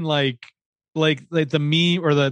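ordinary delivery

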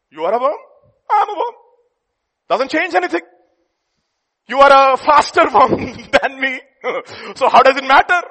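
loud, high, laughing through it; laughing preacher. Real, not acted. Preacher joking with the congregation, loud and laughing. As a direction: let the laugh break into the words.

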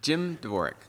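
usual speaking voice

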